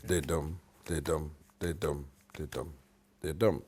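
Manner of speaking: five da-dums, each with a light da and a stressed dum; iambic pentameter beat. Shown as a demonstration. Counting out a verse line's feet, steady da-dum beats. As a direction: chant da-dum five times, evenly. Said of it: "five of those in each line".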